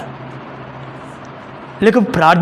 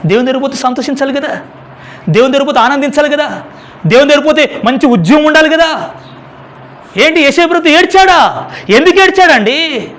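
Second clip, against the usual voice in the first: rising, louder, fast rhetorical preaching; fervent preaching; 8 semitones higher, 6 dB louder than usual. Acted. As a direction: hammer the questions at the congregation. Preacher builds to full force. (ఉత్సాహంగా బోధించడం)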